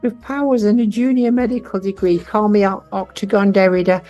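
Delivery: put-on high squeaky baby creature voice; tiny baby dragon voice.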